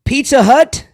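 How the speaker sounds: high, loud game-show announcement; announcer. Acted. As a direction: call it out like a ring announcer. Announcing the matchup.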